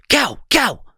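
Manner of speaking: high repeated call of the name; mock call across the street. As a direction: shout it twice to get attention. Stranger keeps calling name.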